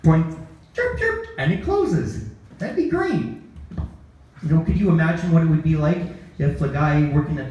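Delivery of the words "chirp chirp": high chirped key fob beeps. Retold or imitated. Comic mimics a casket key fob, high chirps.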